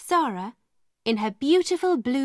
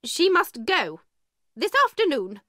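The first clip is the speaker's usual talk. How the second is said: angry; 6 semitones higher than usual. A narrator's reading. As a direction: spit out the order, much higher. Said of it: "Miss Minchin said angrily".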